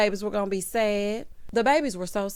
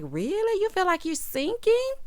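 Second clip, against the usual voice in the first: high-pitched rising disbelief; mock incredulity; 10 semitones higher than usual. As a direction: imitate her questioning him in a high voice. Mimics wife's incredulous question.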